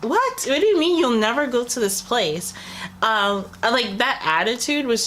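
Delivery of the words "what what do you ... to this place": stunned, can't believe what you just heard; not mad, just baffled and much higher; incredulous disbelief. Retold or imitated. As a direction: pitch jumps high in shocked disbelief. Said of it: "but I'm just like".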